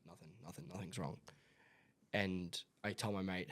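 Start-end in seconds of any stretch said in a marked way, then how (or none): 0.1-1.2 low, soft mumbled denial; acted evasive reply, kept small; dismissive brush-off. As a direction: brush off the question quietly, voice dropped. Acting out brushing off a parent.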